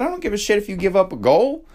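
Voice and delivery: high, quick, insistent dismissal; retold pep talk. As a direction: act out a coaching dad waving off the mistake. Retold firm pep talk to daughter.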